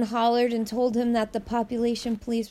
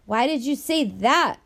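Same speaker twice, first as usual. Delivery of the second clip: sharp raised angry question; furious; 4 semitones higher, 3 dB louder than usual. A narrator's reading. Boy angrily confronting Nina about the police. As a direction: demand an answer, furious. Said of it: "Mateus asked furiously".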